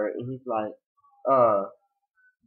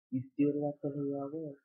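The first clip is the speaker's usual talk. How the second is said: quiet, tender; 9 dB quieter than usual. Retold or imitated. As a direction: murmur it softly. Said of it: "So she's like".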